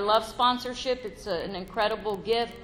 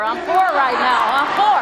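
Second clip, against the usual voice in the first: louder; 3 semitones higher, 11 dB louder than usual. Real, not acted. Joking about richer or poorer.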